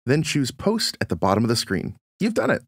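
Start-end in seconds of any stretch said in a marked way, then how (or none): 2.2-2.6 much higher, bright burst of congratulation; excited cheer. Acted. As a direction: cheer the viewer on like they just won. Cheering viewer on finishing steps.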